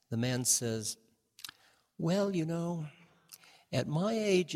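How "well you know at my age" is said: lightly voiced old man, higher pitch; old man's reply. Older man explaining himself to a frog, lightly voiced. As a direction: raise the pitch a little for the man's answer.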